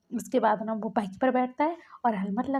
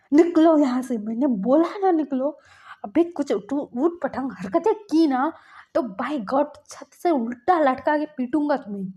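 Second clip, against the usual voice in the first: acted anger; 3 semitones higher, 6 dB louder than usual. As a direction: order them out with a storyteller's raised voice. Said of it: "इतना क्यों गुस्सा कर रहे हो यार".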